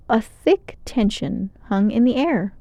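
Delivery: ordinary delivery, nothing marked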